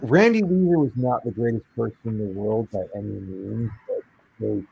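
muffled, as if through a sack of cotton; dull, muffled mic sound. Real, not acted. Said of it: "you sound muffled, my friend".

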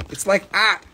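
Boston "art" without the R; Boston accent. Acted. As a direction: drop the R, Boston style. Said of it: "that's how they say it in Boston".

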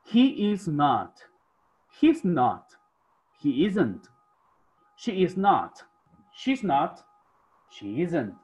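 teacher modelling each phrase slowly and clearly; slow drill voice. Shown as a demonstration. Teacher leading the class in a slow pronunciation drill. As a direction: drill the contractions clearly, one by one. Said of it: "Y practicamos nuestra pronunciación".

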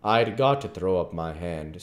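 ordinary delivery, nothing marked

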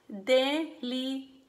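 "Delicado" split as de, li, ca, do; pause between syllables. Shown as a demonstration. Teacher models "Delicado" for pupils to repeat, pausing between syllables. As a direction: say "Delicado" slowly, one separate syllable at a time. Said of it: "con una pausa entre sílabas".

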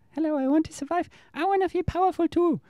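squeaky little ego begging to matter; voicing the small ego. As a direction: play the ego as a small needy creature. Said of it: "my physical ego mind is really small".

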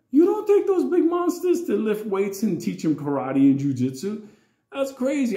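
retold high-pitched outraged disbelief; incredulous objection.

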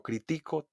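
"critico" with weight on middle TI; stress on TI. Shown as a demonstration. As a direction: lean on TI in "critico". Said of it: "escucha a tu profesor leer estas oraciones". ("critico" acentuado en TI)

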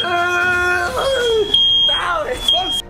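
mock high protest. Mock protest during fire prank.

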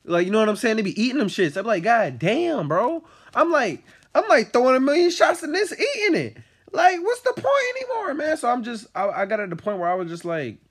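high loud exasperated venting; exasperated rant. Real, not acted. Venting about failing grades, loud.

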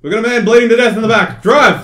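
yelling at the driver to go; loud panicked order; urgent shout. Real, not acted. Urgent ambulance game panic.

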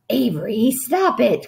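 light exasperated cry. A narrator's reading. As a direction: call the child to order. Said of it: "cried his mother".

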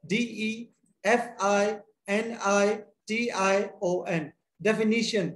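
slow letter-by-letter spelling of डेफिनेशन; dictating letters to students slowly. Shown as a demonstration.

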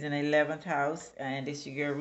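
ordinary talk

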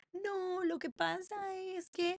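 voz aguda y lenta de amiga; explícate alargando las palabras, en tono agudo; imitando a la chica. Retold or imitated. (imitación aguda de la chica)

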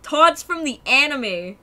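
loud, slow, emphatic exclamation; big drawn-out announcement of a discovery; loud realisation. Real, not acted. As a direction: declare it loudly, word by word.

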